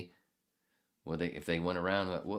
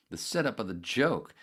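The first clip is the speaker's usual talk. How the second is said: normal talking voice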